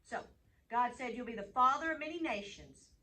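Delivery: ordinary delivery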